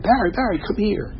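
retold cousin calling out. Cousin calls him urgently.

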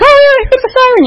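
put-on very high, bright singsong for a little boy; mother hyping a siren for her toddler son; excited mom to a toddler. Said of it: "I'm like".

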